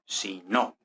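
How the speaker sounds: "si no" in two beats, the accent on NO; stress on no. Shown as a demonstration. Teacher repeating where the accent falls, stress on NO. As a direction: land firmly on NO of "si no". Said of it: "acento prosódico en el no".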